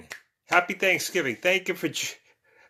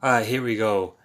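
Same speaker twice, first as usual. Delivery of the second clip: ordinary talk, nothing marked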